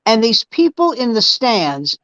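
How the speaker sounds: ordinary talk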